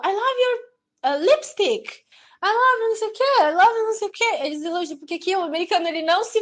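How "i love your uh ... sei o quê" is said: mock American compliments. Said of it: "Eles vêm e elogiam".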